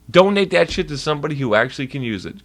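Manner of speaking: ordinary talk, nothing marked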